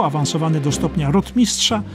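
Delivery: ordinary delivery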